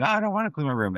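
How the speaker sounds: high-pitched imitation of a reluctant child; mock protesting kid. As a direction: imitate a kid refusing a chore. Acted child whining back.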